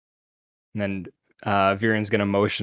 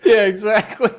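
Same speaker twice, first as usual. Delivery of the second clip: laughing; 12 semitones higher, 8 dB louder than usual. Real, not acted. Laughing at the kid impression.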